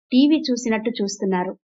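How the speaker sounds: ordinary delivery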